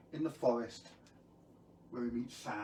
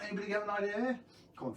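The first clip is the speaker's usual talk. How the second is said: plain reading voice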